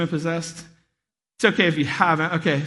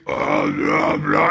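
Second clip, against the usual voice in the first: muffled through wall; 4 dB louder, much slower than usual. Retold or imitated. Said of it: "I hear".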